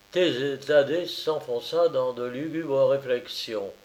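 usual speaking voice

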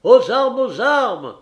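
high, loud battle cry; cri d'alarme. Acted. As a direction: shout the call to arms to everyone aboard. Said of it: "cria-t-il aux voyageurs". (cri aux armes, à pleine voix)

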